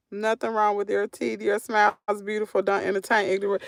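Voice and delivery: ordinary talk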